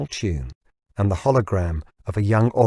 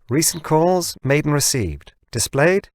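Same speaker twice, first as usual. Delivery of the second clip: much higher, bright machine query; assistant voice; 6 semitones higher than usual. Acted. Hologram assistant confirms the request, higher. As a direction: ask like a helpful device.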